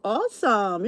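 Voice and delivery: usual speaking voice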